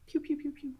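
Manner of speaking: light, high little zapping sounds; playful pew-pew noises. Shown as a demonstration. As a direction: toss off soft, high-pitched pews. Imitated noise for moving around.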